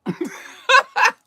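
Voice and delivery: loud, high burst of laughter; delighted laugh. Real, not acted. Storyteller recalling her manager's good news, loud delighted laugh. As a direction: break out laughing loudly.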